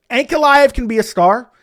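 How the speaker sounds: ordinary talk, nothing marked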